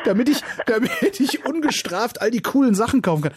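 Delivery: high, laughing through the words; giggling while explaining. Real, not acted. Joking about buying toys.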